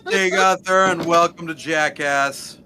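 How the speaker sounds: loud, slow, drawn-out host introduction; show intro parody. Acted. Friend pitching another parody voice line, mock show-host intro. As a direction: announce it big like a stunt show opener.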